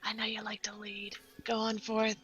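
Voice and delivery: soft, breath-only aside; whisper. Acted. Player sending Dane on ahead, whispering. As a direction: whisper it to the one leading.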